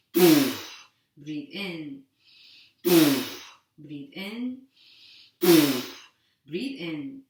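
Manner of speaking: brisk, steady breathing-class count; guided breathing cues. Acted. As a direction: keep the group breathing on each cue. Leads a timed breathing drill.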